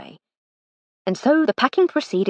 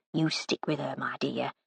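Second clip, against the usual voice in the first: confiding whisper, much softer; whispered aside; 6 semitones lower, 10 dB quieter than usual. Acted. The cook confiding to the departing maid, whispered. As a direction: lean in and whisper it kindly.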